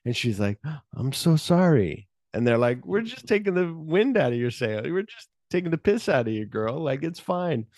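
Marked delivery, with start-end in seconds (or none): 0.9-2.1 higher-pitched acted apology; lightly overdone sorry; mock apology. Acted apology after nationality mistake. 2.8-7.7 high, light retold teasing; imitating friends brushing off an apology; mock reassurance. Acted friends reassuring after teasing.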